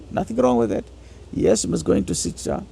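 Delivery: ordinary delivery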